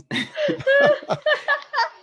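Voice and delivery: long high giggling laugh; giggling at himself, can't stop; high giggle. Real, not acted. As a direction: burst into a high embarrassed giggle. Long laugh after camera trouble.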